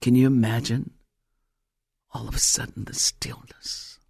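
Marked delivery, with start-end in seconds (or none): 2.1-4.0 very soft, low, near-whisper; hushed. Retold or imitated. Preacher describes the noisy crowd falling still, hushed. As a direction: let the voice drop into sudden stillness.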